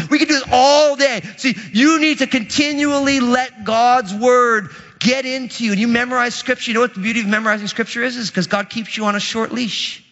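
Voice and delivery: normal talking voice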